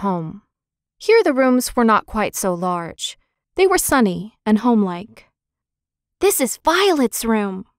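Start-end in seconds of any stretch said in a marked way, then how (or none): 6.2-7.6 put-on a child's light, eager cry; excited cry. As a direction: call it out brightly, a touch higher. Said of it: "cried Benny".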